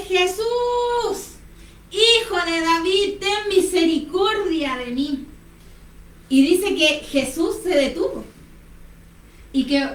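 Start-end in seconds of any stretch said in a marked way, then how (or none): 0.1-5.3 high, drawn-out pleading call; imitated stronger cry. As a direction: cry out higher, stretching the words to be heard. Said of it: "él clamaba aún más fuerte".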